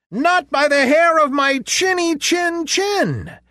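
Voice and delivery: very high squeaky pig refusal; little pig voice. A narrator's reading. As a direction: squeal it out defiantly.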